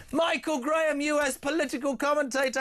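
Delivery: big radio build-up for the name; grand host introduction of the guest; announcer intro. Acted.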